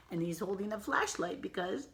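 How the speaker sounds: ordinary delivery, nothing marked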